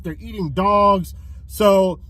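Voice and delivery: ordinary delivery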